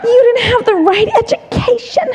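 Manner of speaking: high, half-laughing nagging inner critic; mock the voice in her head, high and whiny. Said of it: "that's how mine speaks to me".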